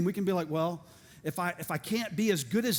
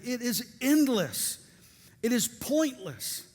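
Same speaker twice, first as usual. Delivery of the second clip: ordinary delivery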